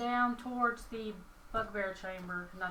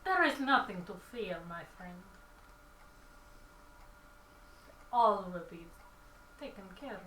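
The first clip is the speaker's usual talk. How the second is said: character voice; 4 semitones lower, faster than usual. Acted. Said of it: "did you say it in your voice".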